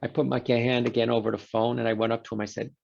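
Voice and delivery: usual speaking voice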